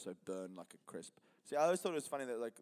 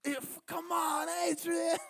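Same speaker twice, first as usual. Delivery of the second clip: very high, loud, drawn-out holler; hammed-up movie cry of agony; agonized yell; 8 dB louder, much slower than usual. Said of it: "When he's yelling in agony".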